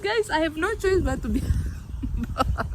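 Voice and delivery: laughing through the words; chuckling mid-sentence. Real, not acted. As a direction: giggle it out, amused at yourself. Laughing at comment about humility.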